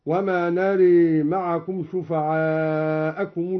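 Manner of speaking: نري with final a tilted to e, "naree"; slow chant, نري as "nare"; imala. Shown as a demonstration. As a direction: bend the long vowel of نري towards e. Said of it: "وأمال نرى".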